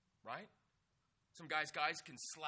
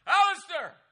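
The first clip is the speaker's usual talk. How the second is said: full yell; 23 dB louder than usual. Retold or imitated. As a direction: yell the name at the top of the voice, much higher. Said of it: "'Cause it's more like".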